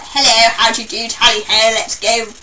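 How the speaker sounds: loud, hammed-up posh English accent; mock the upper-class English accent loudly. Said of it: "really posh people that are like".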